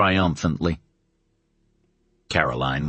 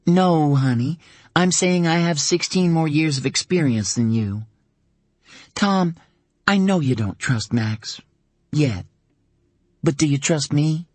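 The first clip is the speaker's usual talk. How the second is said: gentle mother; 9 semitones higher than usual. Acted. Mother coaxing her teenage son to trust her, gentle. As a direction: win him over gently.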